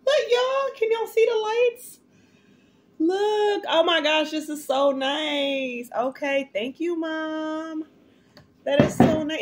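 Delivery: gushing with high-pitched joy; excited high squeal. Real, not acted. Opening mom's gift, squealing thrilled and high.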